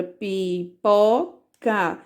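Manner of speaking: "pipoca" slowly split into pi, po, ca; syllable by syllable. Shown as a demonstration. Teacher showing the word formed, "pipoca" slowly syllable by syllable. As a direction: sound out "pipoca" with a gap between syllables.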